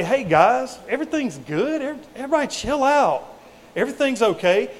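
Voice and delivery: high, breezy, laid-back voice; mock casual reassurance. Pastor mimicking a too-casual Jesus to the disciples, high and breezy. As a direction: brush it off like a mellow buddy. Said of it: "Jesus doesn't say".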